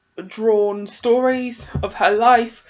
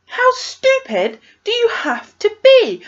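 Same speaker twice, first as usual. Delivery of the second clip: high, slow, louder exasperation; exasperated disbelief; 11 semitones higher, 4 dB louder than usual. Retold or imitated. Reader scolding a foolish book character, exasperated. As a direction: drag it out high, as if appalled at an idiot.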